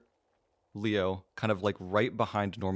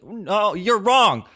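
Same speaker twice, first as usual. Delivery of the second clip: very high, loud protesting voice; high-pitched, stammering objection; Pink; 13 semitones higher, 8 dB louder than usual. Acted. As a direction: insist in a squeaky high pitch. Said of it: "You see Pink go up".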